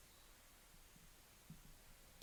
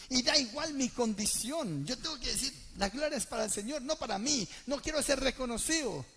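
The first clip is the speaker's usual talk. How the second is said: preaching at full force; 5 semitones higher, 7 dB louder than usual. Acted. Preacher insisting to the church that glory is the Lord's, loudly. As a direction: declare it so the whole church hears.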